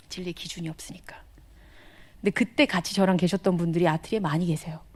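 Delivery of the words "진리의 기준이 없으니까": whispered, much softer; reflecting on a life without truth, whispered; whispered reflection. Real, not acted. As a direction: say it under your breath, sobered. Sober reflection on past.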